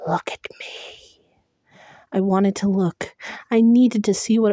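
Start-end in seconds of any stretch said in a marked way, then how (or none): 0.1-1.2 hollow breathy whisper, very soft; creature whisper. Acted. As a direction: lure them in a ghostly whisper. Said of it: "hollow voice whispered".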